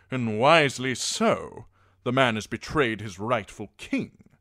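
loud, emphatic knight's declaration; proud knight pronouncing judgement; Sir Axel, forceful. A narrator's reading.

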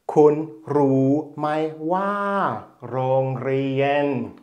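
"Kun Ru Mai Wa, Rongrien" drawn out slowly with its tones; teacher reads "Kun Ru Mai Wa, Rongrien" slowly for the class; slow Thai sentence. Shown as a demonstration. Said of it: "Der Satz heißt also".

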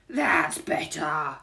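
slow, loud burglar voice; stretched, hearty burglar exclamation; relieved burglar. A narrator's reading. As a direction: draw it out with relief. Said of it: "said Burglar Bill".

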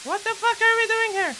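high panicked yell; panicked shout. Real, not acted. Scared gamer in dark haunted house.

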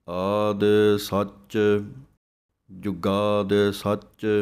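ਸੱਚ said tight and firm, slowly; slow chant with a clipped ਸੱਚ. Shown as a demonstration. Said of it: "ਸੱਚ ਨੂੰ ਕੱਸ ਕੇ ਬੋਲਣਾ ਹੈ". (ਸੱਚ ਕੱਸ ਕੇ, ਹੌਲੀ ਹੌਲੀ)

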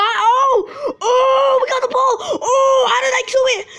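overjoyed gamer yelling; excited shriek. Real, not acted. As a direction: scream with disbelieving joy. Wild reaction, laugh-cry shouts.

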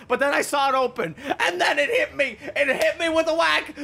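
can't stop laughing as he tells it; breathless happy laughter; laughing while talking. Real, not acted. Laughing while recounting the find.

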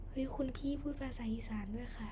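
surprised whispered aside. Real, not acted. Host marvelling at her own Isan, in a whisper. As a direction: whisper it to yourself, amazed.

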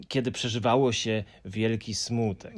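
usual speaking voice

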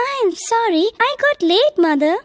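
put-on high, quick apologetic child; poor child. A narrator's reading. Poor child apologising to her mother for being late, high and meek. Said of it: "said the poor child".

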